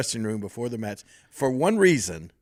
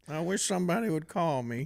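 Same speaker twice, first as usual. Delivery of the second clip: higher-pitched, mock forlorn imitation; Terry Funk; 5 semitones higher, 3 dB quieter than usual. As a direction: impersonate a neglected friend feeling sorry for himself. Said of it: "poor Terry says".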